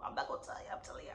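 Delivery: whispered aside, voice dropped away; whispering a secret to listeners. Real, not acted. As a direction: lean in and whisper it.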